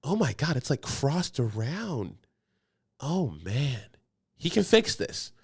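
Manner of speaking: slow, drawn-out mock nurse; nurse's voice. Mimicking the nurse who doubts the doctor, slow and drawn. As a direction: drag it out like a gawking nurse. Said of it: "she was just like".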